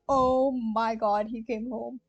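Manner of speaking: usual speaking voice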